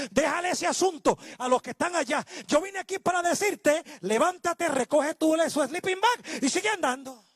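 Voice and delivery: high-pitched, driving sermon delivery; full-force preaching. Acted. Preacher retelling the healing of the paralytic to the congregation, full-force preaching. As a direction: preach it out in insistent, ringing phrases.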